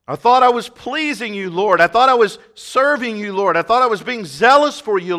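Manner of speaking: loud, high, pleading protest; pleading Saul. Acted. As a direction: plead your case as the bewildered zealot. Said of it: "Here's Saul".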